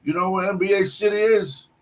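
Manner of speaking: a light put-on old-timer asking the way; old man voice. Old stranger asking the young cook for directions, mock old-man voice. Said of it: "I hear he goes".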